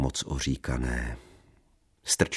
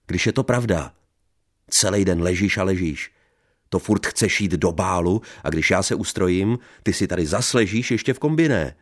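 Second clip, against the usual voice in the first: loud, high furious rant; angry shouting; 4 semitones higher, 6 dB louder than usual. Acted. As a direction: shout down at her. Husband yells at wife. (vzteklý křik)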